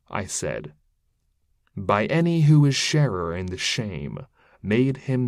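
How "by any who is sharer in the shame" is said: ordinary delivery, nothing marked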